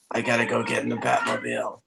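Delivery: a hint of a gravel-voiced caped hero; Batman voice. Joking for the stream, a light Batman growl. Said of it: "I can maybe do Batman".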